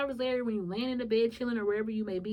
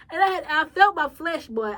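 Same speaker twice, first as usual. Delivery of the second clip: higher and louder, worked up; excited; 6 semitones higher, 9 dB louder than usual. Real, not acted. Telling viewers how her flesh rose up, worked up. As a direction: let it rise as you tell it. Recalling anger rising, laughing.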